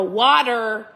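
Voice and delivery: "water" in a badly faked Minnesota accent; overdone Minnesotan "water"; clumsy mock-Minnesota "water". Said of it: "that was really bad".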